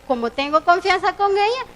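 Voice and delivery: ordinary delivery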